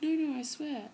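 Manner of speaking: high insistent protest. Retold or imitated. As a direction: insist loudly it's fine. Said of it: "he's like".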